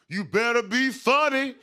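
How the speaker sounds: high, drawn-out heckle from the crowd; yelling heckler. Retold or imitated. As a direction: yell it out at the comedian. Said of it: "and he yells out".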